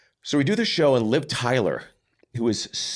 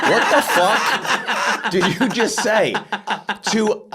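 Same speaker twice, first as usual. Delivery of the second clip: higher, louder and slower, stunned and laughing; retelling a friend's outrageous line, aghast; shocked disbelief; 10 semitones higher, 3 dB louder, much slower than usual. Retold or imitated. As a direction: blurt it out half laughing in shock. Retelling his shock at the remark.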